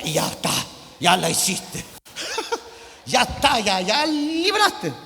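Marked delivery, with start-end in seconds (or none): 2.2-2.8 very high short laugh. Real, not acted. Laughs at his joke.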